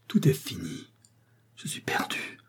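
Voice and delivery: Élise whispers her doom, barely audible and halting; broken whisper. A narrator's reading. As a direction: breathe it out, faint and broken. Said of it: "d'une voix entrecoupée, à peine perceptible".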